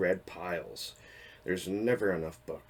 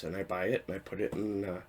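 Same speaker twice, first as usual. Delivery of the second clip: ordinary talk, nothing marked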